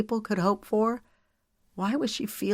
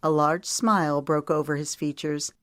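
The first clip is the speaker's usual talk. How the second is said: plain reading voice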